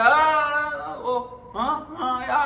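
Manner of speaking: high, drawn-out broken calling; mock can't-hear-you noises; faked bad connection. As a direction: pretend the phone signal is failing. Fakes failing phone line.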